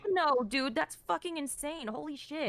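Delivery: high, slow disbelief; stunned outrage at the accusation; shocked. Real, not acted.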